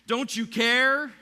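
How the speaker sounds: high, loud and slow cry; desperate yell at God; anguished shout. Retold or imitated. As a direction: drag out the plea at full volume. Crying out to God in storm.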